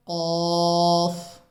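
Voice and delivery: कॉफ drawn out slowly, heavy and rounded; full-mouthed qaaf. Shown as a demonstration. Teacher to viewers, a slow heavy कॉफ. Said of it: "इसको पुर करके पढ़ना है".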